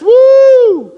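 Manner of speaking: loud, very high cheer; excited whoop. Real, not acted. Preacher cheering a congregant's correct answer, whooping. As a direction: celebrate the right answer.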